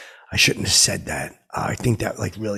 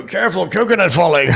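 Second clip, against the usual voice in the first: higher, louder jokey warning; hammed-up playful alert; mock warning; 8 semitones higher, 7 dB louder than usual. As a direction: tease a near-death fall. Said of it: "I do like that".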